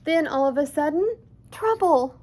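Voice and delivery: storyteller springing the danger on the listeners; dramatic alarm. A narrator's reading. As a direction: announce the trouble with a jolt.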